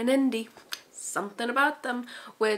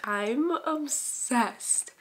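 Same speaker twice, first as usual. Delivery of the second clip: stretched very slow with higher pitch; gushing, drawn out; 4 semitones higher, much slower than usual. Real, not acted. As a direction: linger on each word like a besotted fan. Gushing repeat about the book.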